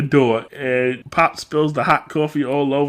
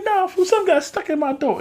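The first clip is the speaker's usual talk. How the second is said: exasperated; 13 semitones higher than usual. Retold or imitated. Exasperated Keenan.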